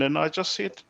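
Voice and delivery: ordinary talk, nothing marked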